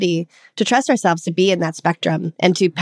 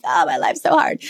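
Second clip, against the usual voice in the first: lower-pitched mock self-pity; mock victim; 3 semitones lower than usual. Coach mocking a victim story, mock self-pity. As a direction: play at feeling sorry for yourself. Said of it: "you have to be a victim and like".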